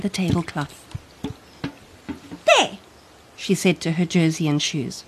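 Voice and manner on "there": put-on high bright child voice, eager; little girl voice. Child character speaking.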